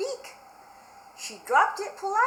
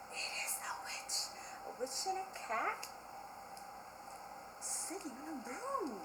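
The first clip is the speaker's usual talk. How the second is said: secret whisper; 12 dB quieter than usual. Real, not acted. Teacher reveals the book cover.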